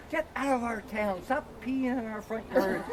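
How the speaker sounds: high-pitched mock yell, held back in volume; retold yelling. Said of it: "yelling at us".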